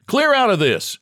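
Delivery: high, urgent order; shouting. A narrator's reading. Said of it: "he shouted".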